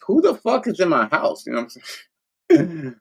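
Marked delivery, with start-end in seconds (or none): 0.1-1.4 higher, alarmed question. Retold or imitated. Alarmed at voices in his house, spooked. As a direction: sound spooked by a noise in the house. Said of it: "I'm like".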